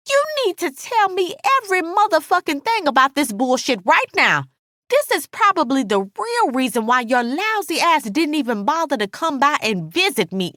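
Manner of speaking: high-pitched angry tirade; indignant, scolding friend; furious Alice. A narrator's reading. Narrator voices angry Alice.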